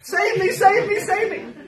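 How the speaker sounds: high, loud shrill-pitched cry for help; mock frantic pleading. Mocking the worshipper crying to a dead idol, high and loud. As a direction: ham up a desperate plea.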